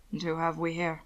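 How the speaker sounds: low, soft, measured question; quiet curiosity. Acted. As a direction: ask it softly, with interest. Lecter sizes up Will.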